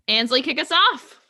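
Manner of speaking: normal talking voice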